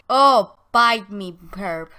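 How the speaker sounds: loud, slow scornful dismissal; scornful snort. Acted. Ben snorting back at Herb in a quarrel, scornful. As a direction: brush them off with loud contempt. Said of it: "she snorts, like".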